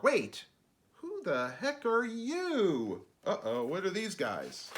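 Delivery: startled, slowing on who the heck; a touch of indignant surprise; surprised narrator. Acted. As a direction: caught off guard by strangers. Narrator interrupted by creatures.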